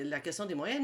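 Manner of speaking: ordinary talk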